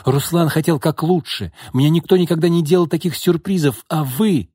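acted upset outburst; through tears. A narrator's reading. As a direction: protest as a hurt, crying girl. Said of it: "сквозь слёзы спросила девушка".

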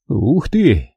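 ordinary delivery

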